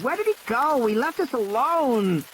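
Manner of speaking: put-on high, slowing child's voice near tears; frightened child. Lex realizes Ed Regis abandoned them, frightened. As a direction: let the fear rise into a wail. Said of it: "Lex said".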